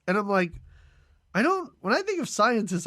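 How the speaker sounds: ordinary delivery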